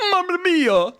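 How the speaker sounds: high, loud fake Italian exclamation; hammed-up terrible Italian accent; bad Italian accent. Imagining a Japanese voice actor attempting Italian, bad Italian accent. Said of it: "bad Italian accent, saying".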